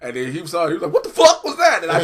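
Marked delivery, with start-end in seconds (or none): 0.9-1.8 loud, high, retold cry of shock; shocked outburst. Co-host retelling Winston's reaction to the scene, loud shocked yell. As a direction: imitate someone blurting out in disbelief.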